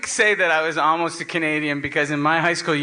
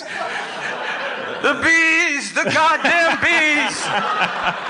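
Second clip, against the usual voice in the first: higher, very slow mock anguish, laughing; Wicker Man bees scream; 9 semitones higher, much slower than usual. Quoting the Wicker Man bees line to his cohost, hammed-up wail.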